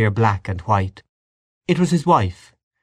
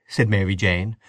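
ordinary delivery

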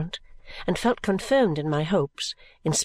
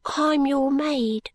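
put-on high, slow, soft child servant; drawn-out little girl's voice; soft-voiced little girl; 7 semitones higher, much slower than usual. A narrator's reading. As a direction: announce it slowly as a small girl. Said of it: "pursued Charley in the same soft voice".

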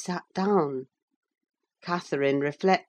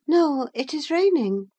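high-pitched voice for Catherine; young Catherine; 10 semitones higher, 3 dB louder than usual. A narrator's reading. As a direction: voice the girl much higher than the narration.

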